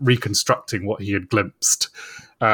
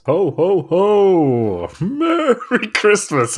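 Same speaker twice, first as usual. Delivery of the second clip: booming Santa laugh, stretched Merry Christmas; high, slow jolly Santa call; 9 semitones higher, 4 dB louder, much slower than usual. Acted. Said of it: "Right, that one kept going".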